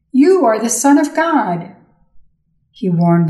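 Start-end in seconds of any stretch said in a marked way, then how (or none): 0.1-1.7 higher, lightly raised reader's cry; narrated shout. A narrator's reading. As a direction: read it as a restrained shout of proclamation. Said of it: "fall down before him and shout".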